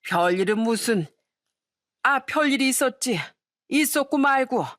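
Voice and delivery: lightly acted tipsy old relative; drunk father-in-law. Drunk father-in-law hints at news to his daughter-in-law, tipsy grumble. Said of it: "만취한 시부가 하는 얘기에".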